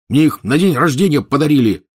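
loud, high yell; barked. Acted. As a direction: bark it back. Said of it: "гаркнул Рон".

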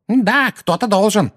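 high, loud mocking echo; drawn-out high-pitched yell; furious wailing. A narrator's reading. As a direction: shriek it back angrily. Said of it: "вопила миссис Фиг".

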